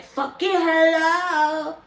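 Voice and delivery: mock scream, high and loud, last word dragged out; drawn-out imitation of a screamed hello; Heaven's screamed catchphrase. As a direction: holler it long and high, mocking her tagline. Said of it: "Heaven screaming hello as she usually do".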